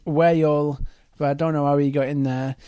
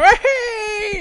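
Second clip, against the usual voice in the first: excited whoop; 6 dB louder than usual. Acted. Cub jumps into water.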